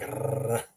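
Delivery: imitated machine gun on a rolled R; kid's play gunfire, tongue trilled. Teacher recalling kids playing guns, machine-gun rolled R. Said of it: "you would have a machine gun".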